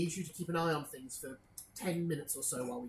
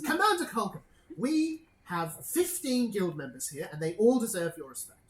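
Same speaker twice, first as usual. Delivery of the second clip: loud, high opening, then firm and measured; stern reprimand; 3 semitones higher, 6 dB louder than usual. Acted. Guild superior scolds commander over respect, stern raised voice. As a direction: pull rank with a raised, emphatic voice.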